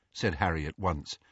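usual speaking voice

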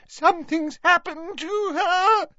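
very high, tearful wail; sobbing. A narrator's reading. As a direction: sob it out in a panic. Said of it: "sobbed Leanne".

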